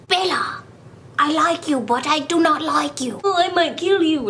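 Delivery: Edward voice. Retold or imitated. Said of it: "I, like, imagined him as".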